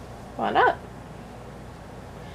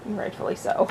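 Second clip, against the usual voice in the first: whispered aside; 9 dB quieter than usual. Real, not acted.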